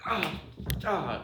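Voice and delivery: loud retold exasperation; mock angry cries. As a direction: ham up a lawyer's outburst. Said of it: "He's really mad".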